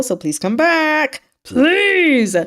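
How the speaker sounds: high, drawn-out begging please; pleading. Retold or imitated. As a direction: beg him to come back, stretching the words. General begs Gu to return.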